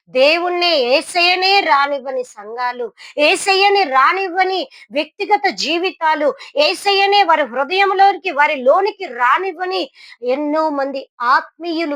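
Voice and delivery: high, loud, rising rebuke from the pulpit; fiery preaching. Acted. Preacher rebuking the congregation, high, loud and fiery. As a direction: hammer home the churches' failure.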